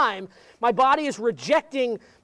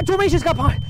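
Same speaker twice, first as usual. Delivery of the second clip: higher cry, straight out of screaming; pained urgency; 5 semitones higher, 3 dB louder than usual. Acted. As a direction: blurt it out to stop the pain. Gasped out to stop the pain.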